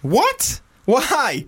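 high-pitched baffled disbelief; astonished, voice shooting up; incredulous. Real, not acted. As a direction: question it in sharp, high disbelief. Incredulous at the brother choice.

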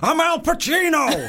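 a hint of Al Pacino; slow, lightly gruff movie-star voice; Al Pacino impression. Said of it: "your Usain Bolt impression".

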